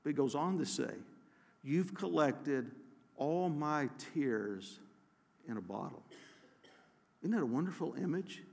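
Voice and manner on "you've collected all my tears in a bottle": tender hushed reading. Acted. Reading comforting psalm verse.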